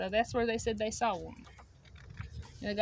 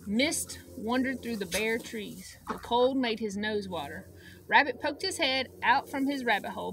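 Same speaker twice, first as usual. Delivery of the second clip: usual speaking voice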